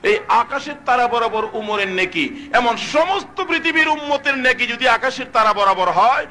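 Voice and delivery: high, climbing preacher's chant; full-force preaching. Acted. Prophet tells Ayesha of Abu Bakr's virtue, building high chant. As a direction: build the comparison to a ringing peak.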